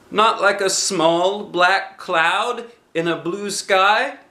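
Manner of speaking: high, slow worried bear voice; anxious Pooh. Acted. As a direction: ask hopefully like a fretting bear.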